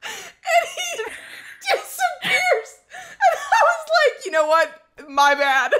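high, loud breathless laughing; laughing through every word; real laughter. Real, not acted. Laughing while telling how he ran from the clown. Laughing retelling him running off.

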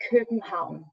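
København in Danish, ending in "-haun"; native Danish pronunciation. Shown as a demonstration. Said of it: "the way we say Copenhagen".

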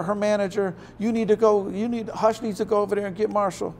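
high, rushed panic, one warning after another; frantic mother on the phone. Retold or imitated. Debbie phoning his mom in a panic, frantic high pleading. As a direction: beg them to send help before it's too late.

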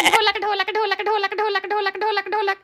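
rhythmic chant like a dholak; mimicking a drum beat. Shown as a demonstration. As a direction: repeat it evenly as drum beats. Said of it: "बोलो तो ऐसा लग रहा बज रही".

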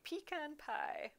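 "pecan pie" slowly as PEE-kahn pie; own pronunciation. Shown as a demonstration. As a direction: draw out "pecan pie", long ee first. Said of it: "I say pecan".

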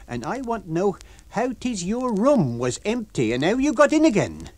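high, loud landlady building up; Mrs Hall, furious. Acted. Landlady demanding how the lodger got in. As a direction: lay down the house rules.

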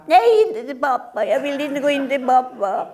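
put-on child refusing the soup; screaming child. Acted child voice. (skrikande barn som vägrar)

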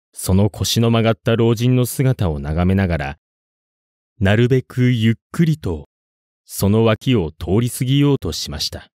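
usual speaking voice

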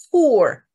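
Poor with a long relaxed oo vowel; easy long poor. Shown as a demonstration. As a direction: say Poor lightly, vowel drawn out. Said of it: "轻松地把它念出来".

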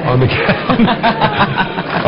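breaking into a high laugh mid-word; words dissolving into a real chuckle. Real, not acted. Commentator laughing at kids' inflatable slide on Capitol grounds.

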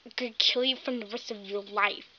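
fast, breathy threatening whisper; menacing whisper. Pretend. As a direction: hiss the threat close to her ear. Pony threatens bully.

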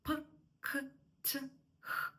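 "П, к, т, х" as isolated puffs of air; breathy bursts on "П, к, т, х"; aspirated. Shown as a demonstration. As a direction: release each of "П, к, т, х" with breath. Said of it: "йдуть з аспірацією, з придихом".

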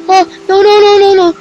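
high, loud panicked cries; real scared protest mid-ride. Real, not acted. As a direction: cry out in real fright. Scary drop on the ride.

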